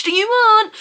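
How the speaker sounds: high, loud mock-dramatic question; dramatic Morpheus voice. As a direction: pose it like a film villain. Mock offer, red pill blue pill.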